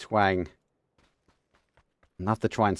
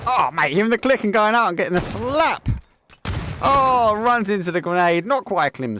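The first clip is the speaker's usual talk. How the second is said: much higher, louder caster yell; excited shout; 12 semitones higher, 6 dB louder than usual. Real, not acted. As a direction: explode as the grenade lands. Player dies to grenade on stream.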